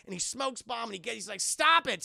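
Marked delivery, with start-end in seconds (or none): 1.4-2.0 loud, high mock scolding; exasperated yell. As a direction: snap at him in exasperation. Gordon annoyed by smoke bomb.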